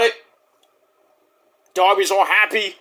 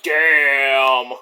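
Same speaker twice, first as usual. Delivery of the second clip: impressed damn; 5 semitones lower than usual. Retold or imitated. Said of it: "I'm like".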